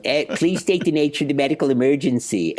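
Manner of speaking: mock Philadelphia accent on a Star Trek doctor line; joking Philadelphia-accented doctor; Philly accent. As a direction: read the starship line in a broad Philly accent. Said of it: "they don't want anybody going".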